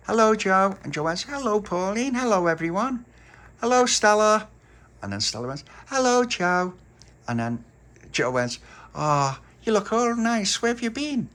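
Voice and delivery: high, loud sing-song hellos; cheery greetings. A narrator's reading. Joe and the girls greeting each other, high and cheery. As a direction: greet everyone brightly.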